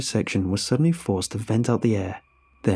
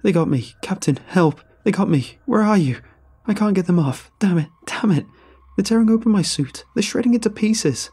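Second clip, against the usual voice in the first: much higher, louder desperate screaming; terrified cries; 10 semitones higher, 4 dB louder than usual. Acted. Ranger being torn apart, screaming to his captain. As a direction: scream for help as they tear at you. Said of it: "Adrian's cries continued".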